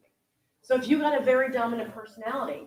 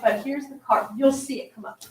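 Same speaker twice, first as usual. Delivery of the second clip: normal talking voice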